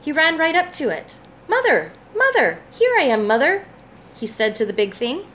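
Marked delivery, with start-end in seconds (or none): 0.0-1.1 gentle, lively picture-book read-aloud; reading to a child. A narrator's reading. Parent reading a picture book to a child, gently lively. 1.5-3.6 higher, louder eager call; excited calling. Acted. Baby bird running up to the big thing, eager and loud.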